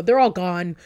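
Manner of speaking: ordinary talk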